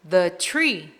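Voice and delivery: "the tree" as "thuh tree"; short uh in "the" before "tree". Shown as a demonstration. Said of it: "som de consoante".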